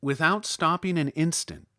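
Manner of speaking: plain reading voice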